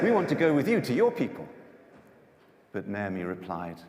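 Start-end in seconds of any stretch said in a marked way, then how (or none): 0.0-1.4 higher, louder and fast, begging; loud plea. Acted. Daughters-in-law begging Naomi to take them, loud fast plea. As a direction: rush out the plea loudly.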